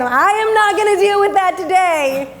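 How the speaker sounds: high-pitched, exasperated refusal; hammed-up parental huff; mock indignant outburst. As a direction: act out fed-up indignation. Acted walkout over son's attitude.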